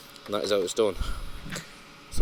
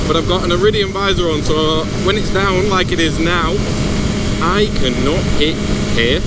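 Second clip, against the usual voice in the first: muffled under a closed visor; talking inside a motorbike helmet; 5 semitones higher, 5 dB louder than usual. Real, not acted. Wind noise when my visor is up.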